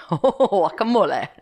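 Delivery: guacamole as a slow "wa-ka-MO-leh" with laughter; laughing Spanish pronunciation. Retold or imitated. Wife playfully repeats guacamole to husband, laughing Spanish-style. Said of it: "How do you pronounce it for me?".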